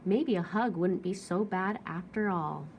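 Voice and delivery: usual speaking voice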